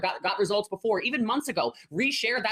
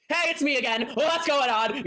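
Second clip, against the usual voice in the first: higher, chirpy, forced-cheerful pitch; anxious salesy check-in; 7 semitones higher, 4 dB louder, slower than usual. Retold or imitated. Coach mimicking a desperate seller messaging a prospect, chirpy and forced. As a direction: mimic a nervous seller pinging a prospect again. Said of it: "you're probably a little like".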